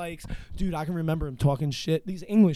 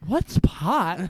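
playing dumb; 2 semitones higher, 4 dB louder than usual.

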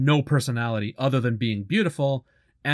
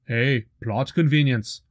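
put-on slow, lightly done Austrian accent; a hint of a thick Austrian accent, slowed. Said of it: "in his thick Austrian accent".

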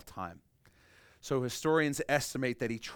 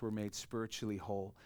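ordinary talk, nothing marked

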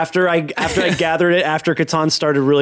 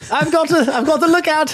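much higher, put-on reassuring call; high call-back; 12 semitones higher than usual. Sneak telling the gang not to worry, high put-on voice. Same character calling from up ahead.

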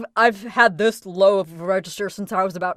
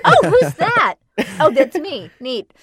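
mock surprise; 5 semitones higher, 7 dB louder than usual. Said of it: "It's always a surprise".

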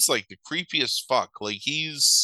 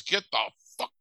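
mock angry order; 8 semitones higher than usual. Police chief throwing her out, retold.

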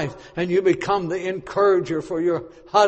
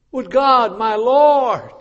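loud plea; 5 semitones higher, 6 dB louder, much slower than usual. Shown as a demonstration. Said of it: "repeated phrase".

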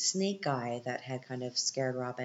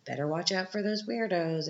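lightly voiced creepy old driver, higher; creepy bus driver; 5 semitones higher than usual. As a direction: warn them like an odd old driver. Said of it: "this bus driver... he's like".

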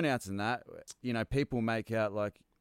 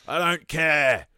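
slow, drawn-out voice, climbing louder to a higher snap; fed-up Boggs; 3 semitones higher, 5 dB louder, slower than usual. Retold or imitated. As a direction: Boggs fed up and done, words dragged out slowly. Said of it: "Boggs turns around and goes".

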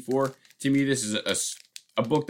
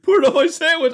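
eager demand; 6 dB louder than usual. Retold or imitated. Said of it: "He's like".

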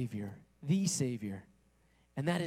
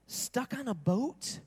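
lightly mocked horror; mock dismay; 6 semitones higher than usual. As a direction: sound trapped by the idea.

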